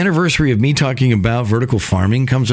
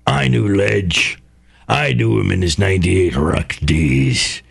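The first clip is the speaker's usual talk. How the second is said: parrot voice; 7 semitones lower than usual. Pretend. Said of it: "talking like a parrot".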